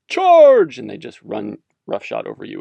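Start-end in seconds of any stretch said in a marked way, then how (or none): 0.1-0.8 loud, high mock charge yell; battle cry. Lord ordering a charge on peasants, loud mock yell. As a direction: bellow it like leading a cavalry charge.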